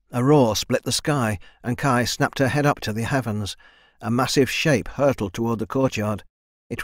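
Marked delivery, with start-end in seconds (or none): none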